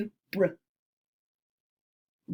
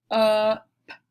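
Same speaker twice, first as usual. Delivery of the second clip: "u" punched louder as the peak of the word; stressed vowel; 7 dB louder than usual. Shown as a demonstration. Teacher to class, showing the middle vowel, stressed loud. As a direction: hit the vowel "u" hard, like the top of a roller coaster. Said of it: "really emphasizing that middle vowel sound".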